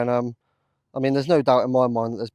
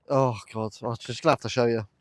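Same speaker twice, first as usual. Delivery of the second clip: normal talking voice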